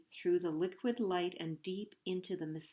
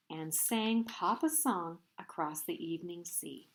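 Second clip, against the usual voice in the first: ordinary delivery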